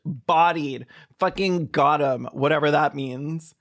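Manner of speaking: ordinary delivery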